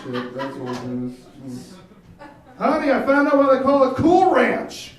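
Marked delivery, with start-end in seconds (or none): 2.6-4.9 high, loud proud announcement; mock discovery. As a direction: boast it like a big find. Character voice punchline, loud high.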